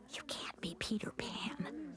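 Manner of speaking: soft slow unvoiced whisper. Natalie whispers to Grace in class, a hushed whisper. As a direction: hush it like a classroom secret. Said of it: "whispered Natalie".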